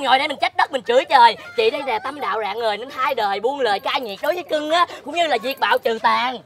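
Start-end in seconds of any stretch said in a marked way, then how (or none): none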